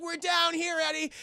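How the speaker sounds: high-pitched distant-style call; hammed-up cry to a rescuer; mock calling up. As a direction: act out stranded friends answering. Stranded friends calling up.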